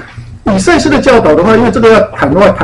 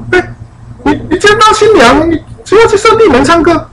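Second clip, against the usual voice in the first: high, stunned disbelief; incredulous wife; 11 semitones higher than usual. Retold or imitated. Bride confronts husband leaving on wedding night, shocked.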